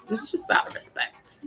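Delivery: breathy whisper, out of respect; whispered aside. Real, not acted. Whispering to listeners about her mom, a respectful hush.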